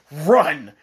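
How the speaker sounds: loud, punched yell; barked-out alarm; urgent warning. Retold or imitated. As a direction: shout it as one urgent command. Repeats the warning to flee.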